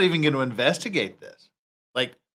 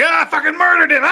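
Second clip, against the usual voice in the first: high, louder, laughing through the fake confession; mock confession, laughing; 11 semitones higher, 5 dB louder, faster than usual. Streamer imagines the suspect confessing on tape, laughing mock voice. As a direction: toss off a cheery laughing confession. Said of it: "And she's like".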